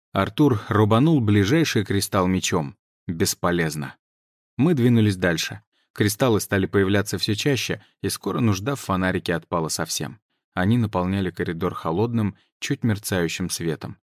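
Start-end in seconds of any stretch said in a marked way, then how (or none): none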